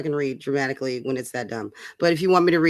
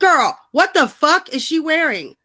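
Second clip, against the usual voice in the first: slow, drawn-out, high disbelief; mock horror; 7 semitones higher, slower than usual. Real, not acted. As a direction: gasp at the outfit in slow horror. Shocked at Jane's outfit.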